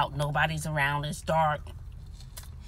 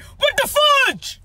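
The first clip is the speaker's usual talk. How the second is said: slow, high, loud outraged yell; drawn-out scream of disbelief; shouted what the fudge; 8 dB louder, much slower than usual. Retold or imitated. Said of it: "this is what I said".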